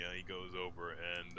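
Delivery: ordinary talk, nothing marked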